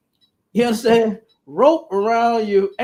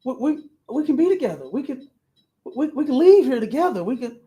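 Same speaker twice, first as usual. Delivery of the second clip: pleading woman; 7 semitones higher than usual. Retold or imitated. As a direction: plead to stay, lightly voiced woman. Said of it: "She was like".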